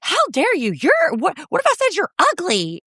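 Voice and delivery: very high, indignant mock shriek; mock-hysterical Angelica. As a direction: pitch it way up in exaggerated outrage. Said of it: "Angelica's voice gets".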